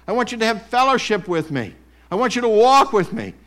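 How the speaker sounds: booming pulpit emphasis; full-force preaching. Real, not acted. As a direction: preach it out to the room.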